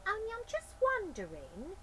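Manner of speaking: high, loud cockney lady voice; a brassy high-pitched woman burglar; Burglar Betty voice. Acted.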